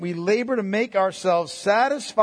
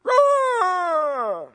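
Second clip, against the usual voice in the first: mock frustrated cry; 10 semitones higher than usual. End of mock outburst.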